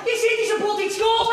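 high shouted objection; indignant quarrelling yell; heated accusation. Acted. Heated quarrel over nationality.

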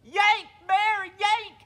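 high, full-voice yell; loud yell. Acted. Rabbit yells into sleeping Bear's ear, loud yell. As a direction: yell it as loud as you can. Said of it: "he yelled as loud as he could".

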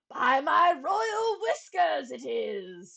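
a king crowing in delight; king delighted by the falling oobleck, crying out; excited cry. Acted. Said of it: "he cried".

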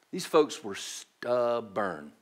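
slow, low Southern American English drawl; Southern English accent. Shown as a demonstration. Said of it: "in the best Southern English I can".

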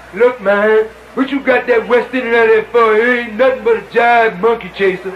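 low, slangy street put-down; mocking American brother. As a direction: imitate a scornful American brother, pitched low. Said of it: "brother from America say to the brother".

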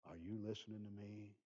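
put-on a parent nose to nose with a three-year-old, speaking softly; real soft. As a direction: lean in and talk real soft to the child. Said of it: "you talk real soft".

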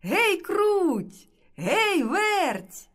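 high-pitched rooster's call; sing-song summons. A narrator's reading. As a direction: call the two mice over, drawn out and high. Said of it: "та й знов гукає".

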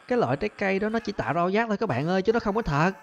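ordinary delivery

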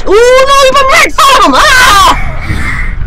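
high, fast panicked yell; panicked shout. Real, not acted. Player put right in front of the pursuer, panicked. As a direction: cry out in alarm, ending on a scream.

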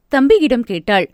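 ordinary delivery

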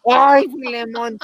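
laughing while faking a moan; mock moaning again. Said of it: "Ay, la". (gemido burlón entre risas)

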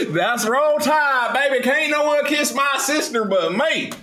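high, slow cheering Alabama fan; mock Alabama fan. The streamer, joking about Alabama, crowing high and slow. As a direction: crow it like a proud fan.

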